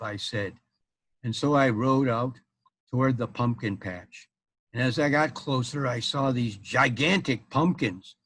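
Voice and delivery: ordinary delivery, nothing marked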